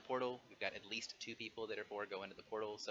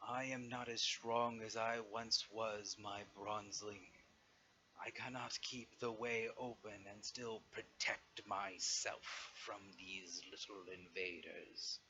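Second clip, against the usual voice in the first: dragon voice; slower than usual. Acted. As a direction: speak slowly like a weary old dragon. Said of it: "Safaraktar's voice calls out again and says".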